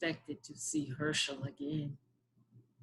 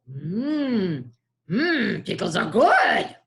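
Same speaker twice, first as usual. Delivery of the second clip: goblin voice; 4 dB louder, much slower than usual. Acted. Goblin eating pickles.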